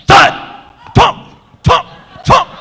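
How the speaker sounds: loud, high, punched thud noises; short explosive mouth-made thumps; imitated thumps. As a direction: punch out each thump, separately. Imitates hopping sounds from cave.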